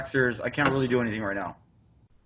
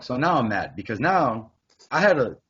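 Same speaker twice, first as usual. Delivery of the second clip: ordinary talk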